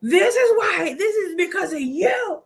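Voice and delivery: high, loud, amused imitation of blame; hammed-up high-pitched complaining; mock brotherly accusation. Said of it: "he kept freaking telling me".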